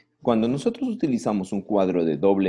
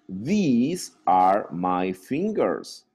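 slow model sentence; much slower than usual. Shown as a demonstration. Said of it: "slow model sentence".

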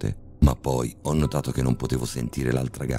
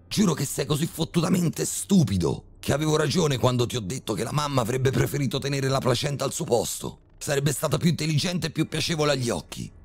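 much higher, raging father's rant; furious yelling; 13 semitones higher than usual. A narrator's reading. Father berating his son at work, furious yelling. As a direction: scream the insults at him. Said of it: "urlò mio padre".

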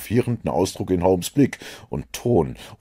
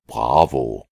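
approving; 7 semitones lower than usual. A narrator's reading. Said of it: "rief Holmes beifällig".

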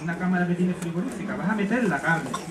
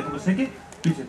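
ordinary delivery, nothing marked

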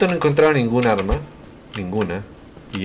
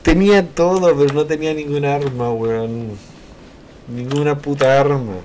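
high, loud frustrated rant; frustrated yell; 5 semitones higher, 5 dB louder than usual. Real, not acted. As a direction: vent the frustration loudly. Frustrated outburst. (queja frustrada y a gritos)

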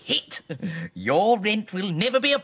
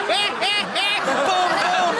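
high hammy cackle with boom boom; big cackle; 12 semitones higher, 3 dB louder than usual. Acted. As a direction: cackle at your own joke. Punchline cackle.

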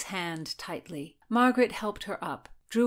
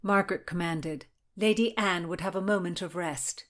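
plain reading voice

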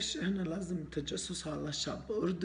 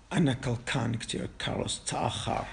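loud complaint; 7 dB louder than usual. Acted. Complaining loudly that Carlos is late.

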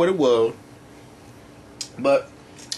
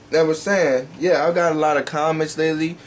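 ordinary talk, nothing marked

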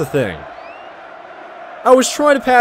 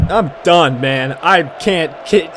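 usual speaking voice

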